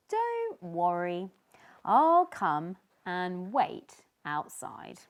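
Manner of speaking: reassuring. Acted. Tiny reassuring Sneezy, slow and steady. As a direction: comfort your nervous friend.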